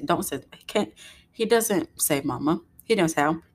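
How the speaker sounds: normal talking voice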